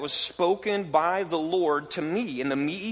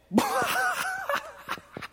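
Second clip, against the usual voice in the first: big booming mock laugh, shouted high; hammed-up roaring laugh at a pipsqueak; gladiator's mocking laugh. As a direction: laugh off a puny opponent. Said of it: "starts laughing".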